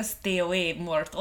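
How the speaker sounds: low, soft Dracula voice in a Hungarian accent. Shown as a demonstration. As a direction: intone it like a Hungarian vampire. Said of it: "can do a Hungarian accent".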